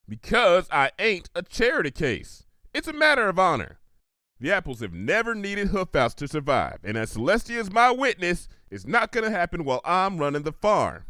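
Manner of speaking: lightly voiced stubborn farm mare, firm; proud farm pony Applejack. Applejack refusing charity to Pinky, proud and firm. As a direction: hold your ground with country pride. Applejack defends her honor.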